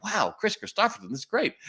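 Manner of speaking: high, thrilled and starstruck; starstruck excitement. Retold or imitated. Clerk hears a star is coming, high thrilled gush. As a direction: gush like a fan who can't believe it.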